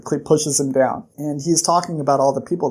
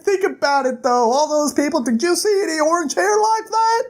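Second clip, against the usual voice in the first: much higher voice, rising to a shout; high insistent voice; 13 semitones higher than usual. Retold or imitated. Kryn arguing with the townsmen, high and rising to a shout. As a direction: push the point, then burst out. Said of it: "They're like".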